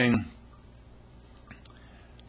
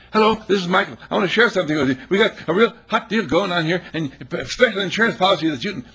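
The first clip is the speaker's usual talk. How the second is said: high, loud, laughing pitch, rushing the end; hammed-up sales call; 9 semitones higher, 6 dB louder, faster than usual. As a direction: oversell it with giggles.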